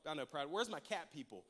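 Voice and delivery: normal talking voice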